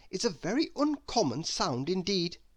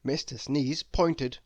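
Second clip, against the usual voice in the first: plain reading voice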